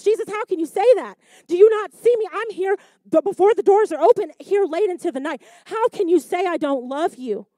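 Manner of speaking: high, loud, wounded protest; indignant churchgoer. Acted. Church members protesting to Jesus, loud and high. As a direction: plead your case to Jesus, hurt. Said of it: "what they were asking themselves".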